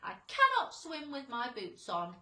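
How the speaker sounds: loud centipede complaint; shouting. A narrator's reading. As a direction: yell it in a hurry. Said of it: "shouted the centipede".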